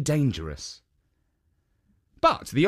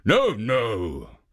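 ogre insisting; 3 semitones lower than usual. A narrator's reading. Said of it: "said the Oni".

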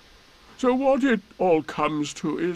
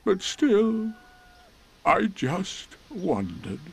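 slow, higher-pitched doleful donkey; most melancholy voice; 5 semitones higher, much slower than usual. Acted. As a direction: drag it out with heavy self-pity. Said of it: "said Eeyore in his most melancholy voice".

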